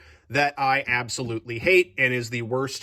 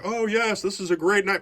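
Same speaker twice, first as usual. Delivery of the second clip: higher, falsely bright sales pitch; fake enthusiasm; 7 semitones higher than usual. Regifting a bad knife to someone, fake cheerful praise. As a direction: oversell it like a lie. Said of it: "be like".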